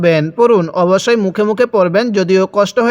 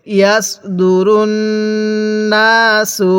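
very slow, drawn-out syllables; model recitation; much slower than usual. Shown as a demonstration. Teacher models Quran words for learners, slow measured recitation. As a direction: stretch each long vowel evenly. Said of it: "কিভাবে শিখব".

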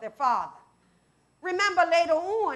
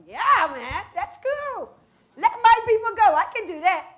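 casual mock agreement; 5 semitones higher, 5 dB louder than usual. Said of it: "You're like".

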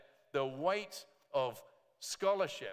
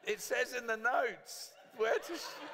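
laughing; 10 semitones higher, 4 dB louder than usual. Real, not acted. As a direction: laugh while pointing out the notes. Laughing about spoiled punchline.

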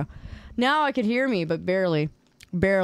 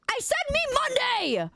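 much higher, hammed-up outrage; mock angry shout; 14 semitones higher than usual. Streamer mock-yelling at chat over the caption. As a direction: yell it like a cartoon tantrum.

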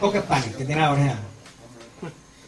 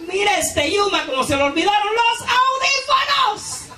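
high-pitched fake whimper; hammed-up crying; mock crying; 14 semitones higher than usual. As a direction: whine it like a fake sob. [name] fakes crying over headphones.

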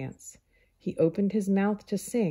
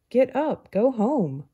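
much higher, louder urging; loud, higher urgent order; urgent command; 7 semitones higher, 6 dB louder than usual. Acted. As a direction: rouse him sharply to get up. Ant urging friend up.

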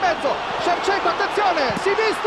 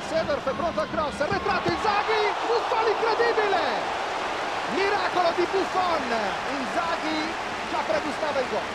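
breathless italian goal commentary; 5 semitones higher than usual. Real, not acted. Buffon's miracle save.

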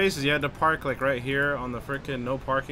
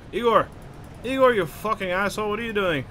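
higher, louder exasperated yell; annoyed shout; 8 semitones higher, 4 dB louder than usual. Real, not acted. Player yelling at a misbehaving game companion, annoyed shout. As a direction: call out sharply in exasperation.

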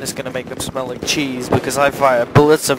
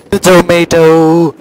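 much louder, higher, stretched-out shout; loud drawn-out cry; 4 semitones higher, 12 dB louder, slower than usual. Real, not acted. Gamer crying out mid-match, loud stretched shout. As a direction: holler it slowly at full voice. Calling out loudly in game.

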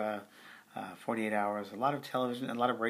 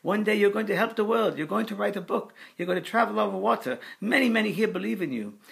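put-on high, loud French-accented proclamation; imitating an excitable French spirit, pitched high; screaming Frenchman; 10 semitones higher, 7 dB louder than usual. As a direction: call out fervently in a French accent. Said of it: "and screaming".

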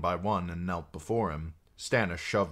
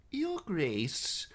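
put-on much higher, thin old man's voice; calm old maester; 12 semitones higher than usual. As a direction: gently address the king. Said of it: "calm as always".

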